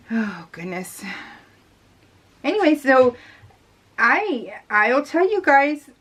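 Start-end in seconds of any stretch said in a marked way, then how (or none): none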